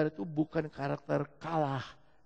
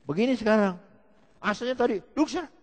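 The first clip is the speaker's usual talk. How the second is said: high, louder mock voice of the man; mimicking the man; 8 semitones higher, 5 dB louder, faster than usual. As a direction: imitate him ordering someone to sit. Mimics man, before and now. (meniru suara orang itu)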